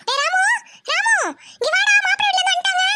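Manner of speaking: high-pitched call across the house; excited mother calling her son. Acted. Mother calls son as guests arrive.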